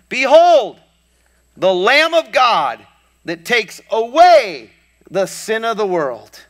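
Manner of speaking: loud, high, slow proclamation; proclaiming shout. Retold or imitated. John the Baptist crying out to the crowd, loud proclamation. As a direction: cry it out like a prophet announcing.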